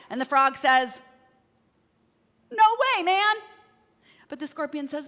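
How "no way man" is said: much higher and louder no way, man; firm refusal. Acted. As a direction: frog flatly refuses, loud and high. Frog refusing scorpion.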